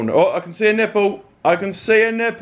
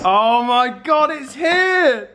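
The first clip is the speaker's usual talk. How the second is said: higher, joyful shout of delight; excited shout; 5 semitones higher, slower than usual. Real, not acted. Unboxing vlogger seeing the new iPhone arrive, excited shout. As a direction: burst out with real excitement.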